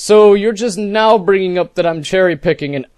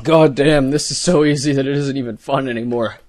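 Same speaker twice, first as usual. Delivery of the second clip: ordinary delivery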